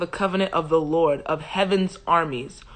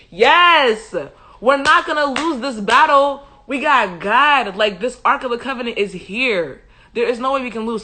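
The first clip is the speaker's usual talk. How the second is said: jubilant, higher and louder at the start; excited cheering; 4 semitones higher, 4 dB louder than usual. Acted. Israelites celebrating the Ark's arrival in camp, excited cheering. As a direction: crow with certain victory. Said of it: "they got so excited".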